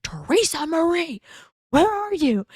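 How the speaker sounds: calls her full name high, like yelling through the house; imitating a husband shouting for his wife; calling out.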